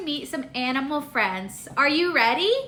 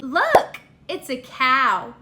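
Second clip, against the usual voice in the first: normal talking voice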